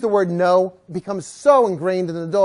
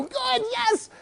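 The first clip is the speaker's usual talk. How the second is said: very high squeal of encouragement; motivating cheer; 13 semitones higher, 7 dB quieter than usual. Shown as a demonstration. Trainer motivating a Pyrenees, high excited cheer. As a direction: cheer hard to get a lazy dog moving. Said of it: "that's gonna have to motivate him".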